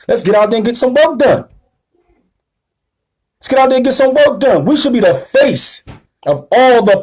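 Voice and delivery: rally the congregation to get to work; rising preacher's exhortation. Real, not acted. Preacher rousing listeners to action, high and stirring.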